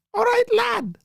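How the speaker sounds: put-on very high, loud English-accented greeting; mock English accent. Mimics an English regional accent.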